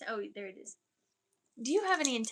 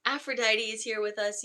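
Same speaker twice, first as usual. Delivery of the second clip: usual speaking voice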